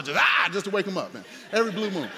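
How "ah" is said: holler. Retold or imitated. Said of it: "I just holler".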